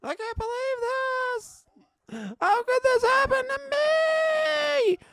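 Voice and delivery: very high, slow, wailing lament; shrill-free falsetto wail, slow and dragged; mock despair. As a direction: ham up her tragic disbelief. Mocking the arrested woman.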